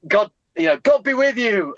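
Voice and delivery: high, fervent blessing; heartfelt blessing. Retold or imitated. Old man blessing them from the van window, high and fervent. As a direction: call it up high with feeling. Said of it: "he went".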